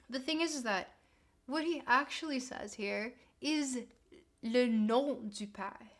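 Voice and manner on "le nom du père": slow, drawn-out Québécois take on le nom du père; Canadian French accent. Shown as a demonstration. Streamer reading Lacan to chat, slow Canadian French accent. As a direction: say it like a Montrealer, slowly. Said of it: "that was my Canadian accent coming through".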